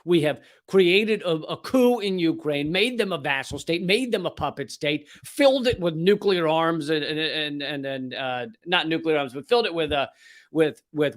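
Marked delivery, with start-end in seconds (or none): none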